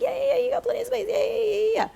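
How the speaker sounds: high, rapid string of yeahs; squeaky-high, hurried fake agreement; mock brush-off. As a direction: imitate a glib agent waving off concerns. Agent brushing off her stated needs.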